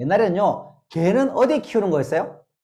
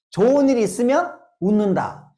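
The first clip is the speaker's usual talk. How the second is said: ordinary delivery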